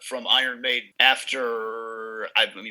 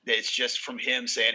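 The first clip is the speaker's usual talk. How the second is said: ordinary talk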